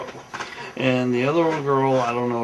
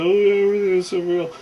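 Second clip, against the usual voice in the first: mocking imitation; 6 semitones higher, 3 dB louder, much faster than usual. Mocks the clueless liar's excuse.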